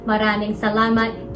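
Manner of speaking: usual speaking voice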